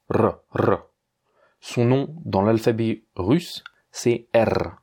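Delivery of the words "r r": trilled "R, R" with the tongue tip; rolled R. Shown as a demonstration. Teacher demonstrating a rolled Russian R. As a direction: roll each R clearly. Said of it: "est un R roulé".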